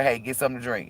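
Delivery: a lazy suggestion, softly; the body shrugging that anything to drink will do; casual body voice. Retold or imitated.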